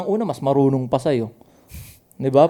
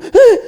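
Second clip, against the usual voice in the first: mock startled yelp, high and loud; gulat na reaksyon; 9 dB louder than usual. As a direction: biglang tili na parang nagulat. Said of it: "magugulat ka". (ginagayang gulat na sigaw)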